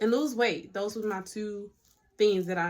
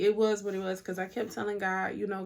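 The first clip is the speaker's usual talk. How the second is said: ordinary talk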